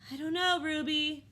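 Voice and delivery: doubtful elder elephant to Ruby. A narrator's reading. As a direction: sound unsure of the plan. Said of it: "Akello sounds doubtful".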